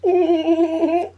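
giggling through the words; laughing. Real, not acted. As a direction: laugh while saying it. Laughing after dirty joke.

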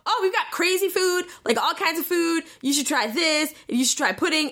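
high, over-eager people-pleasing; mock freaking out. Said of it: "like, freaking out and is trying to".

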